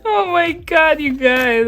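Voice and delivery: high-pitched, helpless through laughter; laughing. Real, not acted. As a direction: gasp it out to viewers after a big laugh. Laughing at being nervous again.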